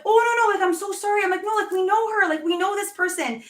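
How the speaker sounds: high, flustered protest; flustered apology. Retold or imitated. Fan explaining to people in line, flustered and high.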